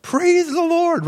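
high, drawn-out, slow cry of praise; joyful praise. Retold or imitated. Crowd rejoicing as the girl rises, high slow praise. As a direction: stretch it out in high joyful worship.

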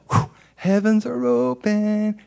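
high, slow, drawn-out wonder; blissful relief. Retold or imitated. Husband back in prayer room, blissful and high-pitched. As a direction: stretch it out high, as release arrives.

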